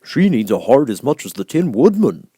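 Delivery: character voice. Acted. Character quote in story reading.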